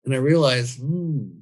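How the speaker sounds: ordinary delivery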